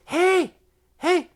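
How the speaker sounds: high, loud call to a friend behind. Retold or imitated. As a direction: hail a friend across the plane seats. Lady calling her friend.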